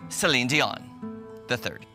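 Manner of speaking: ordinary delivery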